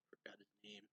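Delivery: muttered in a whisper under the breath; a quick whispered aside to self. Real, not acted.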